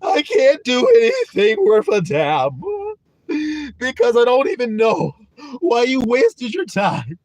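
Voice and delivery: high, loud mock sob to God, slowing as it goes; hammed-up tearful plea ending in a cry; whiny begging. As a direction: beg like a pitiful wretch, half crying. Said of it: "Begging God".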